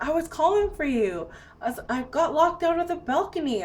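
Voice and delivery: high mock-whiny reproach; retold plaintive complaint. Complaining to sleeping partner.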